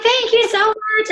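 high, slow, effusive thank-you; gushing thanks. Retold or imitated. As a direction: pour out relieved gratitude, slowly. Retold gratitude after license found.

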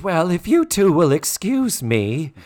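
high, loud, drawn-out exit line; hammed-up huffy housemother; Mrs. Garrett impression. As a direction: announce it pointedly, stretching the words. Voices Mrs. Garrett making her exit.

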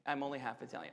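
a guilty little confession, dropped low; sheepish admission. Real, not acted. Preacher confessing his heritage, low and soft. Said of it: "I'm admitting".

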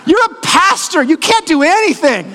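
high-pitched imitation of a fed-up little girl; exasperated Addie. Daughter telling pastor dad he's no nurse, high exasperated blurt. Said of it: "in her exasperation, she just blurts out".

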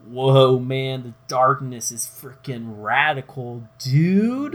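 stoner surfer voice, loud and high; surfer dude. Retold or imitated. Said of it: "they weren't like".

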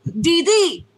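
loud, high cartoon Dexter with a French accent; high-pitched French-accented cartoon outburst; Dexter's French accent. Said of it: "is I think of Dexter".

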